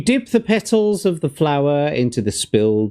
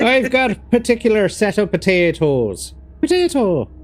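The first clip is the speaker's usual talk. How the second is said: high stage-Irish voice with laughter; mock Irish accent; 8 semitones higher than usual. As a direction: giggly parody in an Irish accent. Echoes the mock Irish potato threat.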